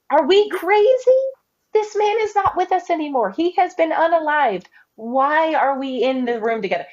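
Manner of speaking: high, incredulous disciple voice; mock disbelieving disciple; exasperated disciple. Disciples voiced in retold scene.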